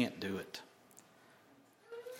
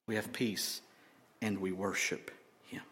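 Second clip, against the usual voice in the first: usual speaking voice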